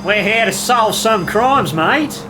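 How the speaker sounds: mock Australian accent. Joining the Australian joke.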